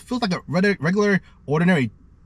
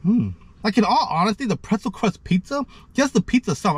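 chewing while talking; speaking around food; mouth full. Real, not acted. Seen 1: Bite taken before; cheeks working, pizza held near mouth while talking.

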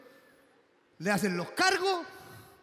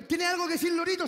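reporter question; 8 semitones higher, 3 dB louder than usual. Acted. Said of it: "Los de televisión se acercaron".

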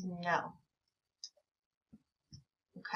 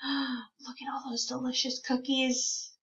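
mock gasp; 4 semitones higher, 5 dB quieter than usual. Teacher marvels at cookie picture.

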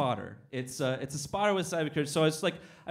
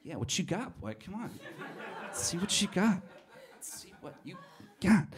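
playful taunt; 2 semitones higher, 4 dB quieter than usual. Retold or imitated. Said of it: "he's kinda looking at me playfully".

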